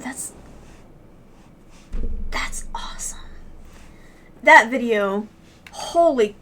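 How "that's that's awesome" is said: a soft, nearly voiceless whisper of awe; awed whisper. Real, not acted. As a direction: let it slip out under your breath, moved. Moved streamer reacting to clip.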